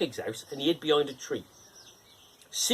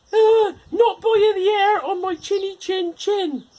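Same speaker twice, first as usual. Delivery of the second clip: very high, loud squeaky pig voice; little pig refusing the wolf; 11 dB louder than usual. Acted. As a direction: refuse in a tiny defiant squeak. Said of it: "said the little pig".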